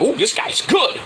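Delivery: high, admiring mock-female coo; impressed women onlookers. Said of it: "the women were like, watching".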